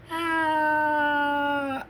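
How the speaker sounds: long, high mock scream; hammed-up sustained "aah" of someone falling. She screams as gorilla drops her.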